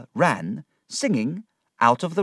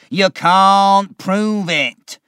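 loud, slow and defiant, pitched up high; shouting; 7 semitones higher, 9 dB louder, much slower than usual. A narrator's reading. Uriah shouting back at Micawber, loud and slow. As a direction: spit it out as a furious denial. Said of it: "Uriah shouted".

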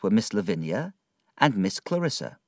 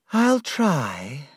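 reluctantly sad; 6 semitones higher than usual. Acted. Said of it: "She sounded sad".

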